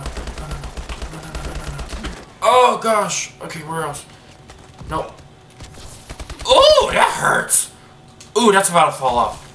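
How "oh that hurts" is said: high, slow pained whine. Real, not acted. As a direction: stretch it out in pain. Clothespin pinching, drawn-out whine.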